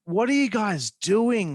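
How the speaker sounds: slow, high exasperated question. Real, not acted. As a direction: throw up your hands at them. Exasperated rhetorical question to WWE.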